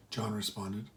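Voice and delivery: ordinary delivery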